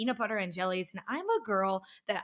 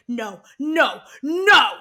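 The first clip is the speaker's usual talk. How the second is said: each "no" louder and higher; mock rising panic; 4 semitones higher, 12 dB louder, much slower than usual. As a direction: imitate a friend's escalating alarm. Retold friend panicking, escalating refusal.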